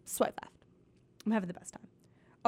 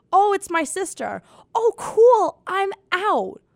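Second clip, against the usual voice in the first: mock exchange; 7 semitones higher, 6 dB louder, slower than usual. Mocking Tinder bios, acted reactions.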